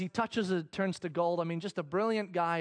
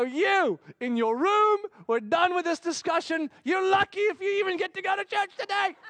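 higher, firm scolding; stern dad; 11 semitones higher, 5 dB louder than usual. Retold or imitated. Dad to tearful daughter, sternly scolding. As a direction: banish her to her room.

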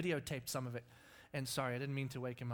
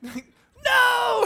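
very high, very loud shout of despair; anguished shout; 19 dB louder than usual. Retold or imitated. Beachgoer chased by gnats, shouting in despair. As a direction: cry out as the gnats return. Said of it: "And it's like".

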